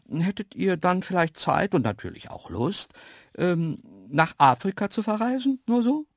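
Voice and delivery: coaxing. Acted. Narrator proposing an Africa trip, coaxingly casual. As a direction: float the idea innocently, rising at the end. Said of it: "fing ich harmlos wieder an".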